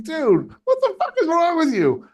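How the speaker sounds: high, loud retold rebuke; exasperated scolding. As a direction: act out telling your buddy off. Retold scolding of his friend.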